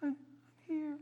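high, faint and far-off; barely audible, a buried child calling; very weak voice. Retold or imitated. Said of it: "a very weak voice saying".

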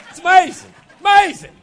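loud, high exclamation for laughs; mock amazement. Preacher joking he is thirty-five yet married forty years, mock amazement. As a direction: marvel at yourself in hammed-up wonder. Jokes about his own age.

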